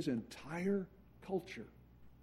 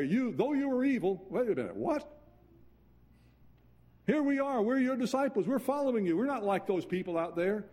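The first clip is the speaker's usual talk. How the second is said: higher, louder offended protest; indignant disciple; 7 semitones higher, 3 dB louder than usual. Retold or imitated. Disciples protesting Jesus called them evil, high indignant outrage. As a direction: bristle like an insulted follower.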